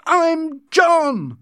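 very high, loud furious cry; angry shout. A narrator's reading. John losing control at Linda, furious shout. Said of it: "he shouted angrily".

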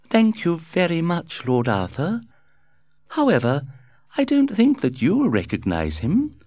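high, chirpy, breezy society lady; gushing society hostess. A narrator's reading. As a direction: flutter through it like a gossiping hostess. Lady Windermere speaks.

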